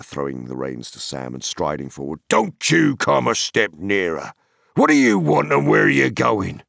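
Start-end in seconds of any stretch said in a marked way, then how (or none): 2.3-6.6 loud, high demanding farmer; stern challenge. A narrator's reading. Farmer challenging the dark rider, loud and demanding. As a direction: challenge the stranger, rising to a shout.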